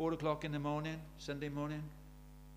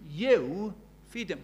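long, weighty YOU in "You feed them"; stress on YOU; 2 semitones higher, 5 dB louder, much slower than usual. Retold or imitated. Jesus putting it on the disciples, emphatic YOU. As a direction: draw out YOU slowly and louder. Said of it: "which was emphasized in the Greek".

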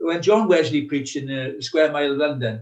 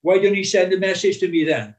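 louder, higher stroppy heckle; mock student heckle, raised; heckling shout; 4 semitones higher, 6 dB louder than usual. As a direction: jeer like a rude student. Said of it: "shouting out".

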